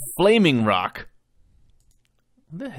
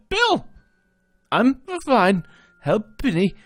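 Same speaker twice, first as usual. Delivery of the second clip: wounded soldier gasping; 9 semitones higher, 5 dB louder than usual. Acted. Wounded companion calls out.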